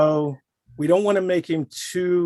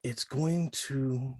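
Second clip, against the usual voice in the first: warm and floaty; 8 dB quieter than usual. Shown as a demonstration. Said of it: "very warmly, very floaty almost".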